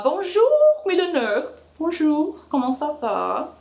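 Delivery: hammed-up French greeting, pitched high and bright; playful French accent read; mock French. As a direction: sing out Bonjour like a jokey French host. Reading a French comment aloud.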